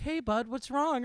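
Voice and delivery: high, loud mock-concerned dad; imitated dad asking what's up. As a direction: check on your upset little kid. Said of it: "He's like".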